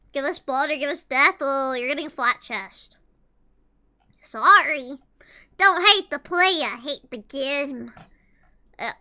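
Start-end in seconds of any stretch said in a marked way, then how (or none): none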